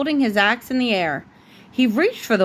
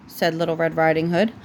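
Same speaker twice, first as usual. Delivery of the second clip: plain reading voice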